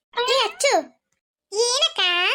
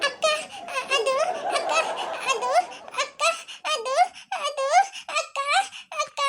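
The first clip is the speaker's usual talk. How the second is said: short halting words with long gaps; hesitant, broken-up stammer; stammering hesitation; 6 semitones higher, 5 dB quieter than usual. Acted. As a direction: stall helplessly, stuck for an answer. Caught out, cannot answer sister.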